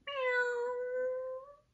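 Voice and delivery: very high, soft meow; a sweet drawn-out kitty mew; high, gentle cat call. Acted. Cat meows again.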